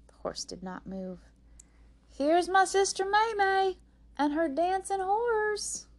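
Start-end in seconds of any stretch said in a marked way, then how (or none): 2.1-5.9 very high, loud, stretched-out introduction; insistent stage announcement. A narrator's reading. Rose repeats the introduction as the horse stalls, announcing insistently. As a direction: announce it bigger, straining to fill the hall. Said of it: "Rose said again".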